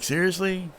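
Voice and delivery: high, incredulous "Seriously?"; incredulous Shaft. Retold or imitated. Shaft balking at the teammate's stunt, incredulous. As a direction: pitch it up in disbelief. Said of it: "Shaft's like".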